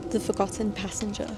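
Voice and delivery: ordinary delivery, nothing marked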